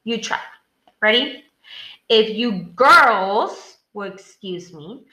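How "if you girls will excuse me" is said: put-on "girls" drawn out in a louder voice; stress on girls. Shown as a demonstration. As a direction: punch "girls" loudly, mockingly. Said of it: "read that in a louder voice".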